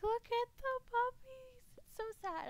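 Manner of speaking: high, slow, teary wail; imitating bawling. Streamer reenacting crying at dog clips, high tearful wail. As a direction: reenact sobbing over the puppy clips. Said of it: "I started bawling my eyes out".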